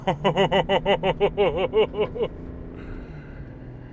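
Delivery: thrilled laugh at the power; delighted laugh. Real, not acted. Reacting to truck power.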